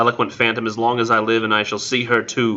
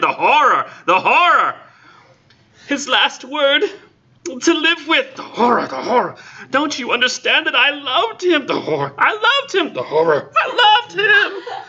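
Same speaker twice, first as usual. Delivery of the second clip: comic overlap of horror and love cries; hammed-up anguished outcry. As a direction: wail both voices as if going mad. Horror chant cut by the Intended.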